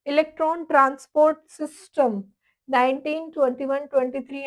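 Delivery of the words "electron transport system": slow chapter title. Real, not acted. Announcing topic name.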